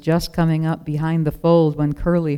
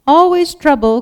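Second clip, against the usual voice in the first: high, loud sheep bleat; loud bleating complaint; 11 semitones higher, 9 dB louder than usual. A narrator's reading. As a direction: bleat it out like a grumpy flock. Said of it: "came several more bleats".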